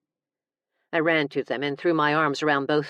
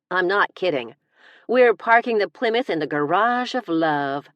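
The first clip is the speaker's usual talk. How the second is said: higher, louder insistence; cheeky mock declaration; insistent; 4 semitones higher, 5 dB louder than usual. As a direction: build it up playfully. Escalating innuendo.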